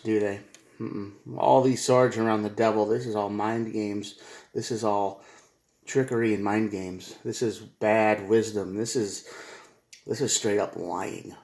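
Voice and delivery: normal talking voice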